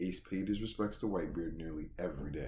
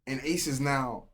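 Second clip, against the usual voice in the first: hyped retelling; 6 semitones higher, 6 dB louder than usual. Real, not acted.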